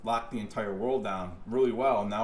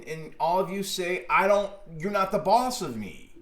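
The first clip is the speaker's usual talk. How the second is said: 1 heated anger; 6 semitones higher than usual. Real, not acted. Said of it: "this is normal anger".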